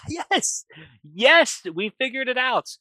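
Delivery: jubilant high-pitched yelling of yes; retelling Grace's triumphant cheer; excited cheer. Retold or imitated. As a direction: celebrate the breakthrough at the top of the voice. Scientist's breakthrough, cheering.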